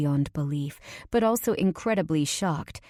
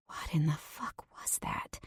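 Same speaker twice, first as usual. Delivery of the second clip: whispered; 10 dB quieter than usual. A narrator's reading. Shaken after fleeing, inner thought.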